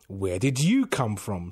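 ordinary delivery

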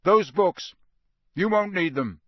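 rough, commanding veteran voice; a battle-scarred teacher barking orders; gruff old auror. A narrator's reading. Moody's gruff voice.

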